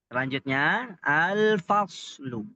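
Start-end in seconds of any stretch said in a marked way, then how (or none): 1.0-2.4 al-faslu read slowly, lam sounded; stretched-out al-faslu with a clear l. Shown as a demonstration. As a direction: let the l of al-faslu be heard. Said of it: "Terdengar jelas alif lamnya".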